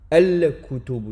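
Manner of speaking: Al-kutubu, lentement, le lam bien prononcé; bien faire entendre le lam de Al-kutubu; al-kutubu articulé. Shown as a demonstration. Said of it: "Donc on prononce le lem". (prononcer le lam de Al-kutubu)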